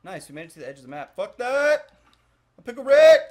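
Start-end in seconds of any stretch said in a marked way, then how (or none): 1.2-1.9 high, loud shout of refusal; loud yell. Real, not acted. Streamer yelling at an enemy in the game, loud and high. 2.6-3.2 high, loud shout of refusal; loud yell. Real, not acted. Streamer yelling at an enemy in the game, loud and high.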